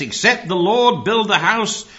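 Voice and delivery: booming sermon delivery; thundering. Retold or imitated. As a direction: thunder the scripture out. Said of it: "he then thundered the text".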